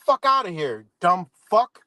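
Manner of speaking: ordinary talk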